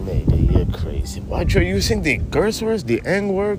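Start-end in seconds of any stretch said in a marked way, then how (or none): 1.2-3.6 a slightly higher, easygoing stoner-buddy voice; laid-back stoner buddy. Acted. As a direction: play the mellow stoner pal who ends every line with man. Buddy character objects to N-word.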